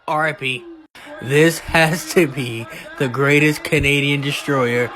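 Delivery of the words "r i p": usual speaking voice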